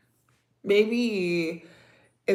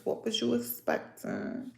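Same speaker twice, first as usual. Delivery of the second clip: breathy whispered aside, pitch up; whispered repeat; 4 semitones higher, 9 dB quieter than usual. Real, not acted. Wife pointedly whispering the question to her husband.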